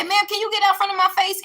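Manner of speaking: high-pitched re-enacted indignation; indignant confrontation.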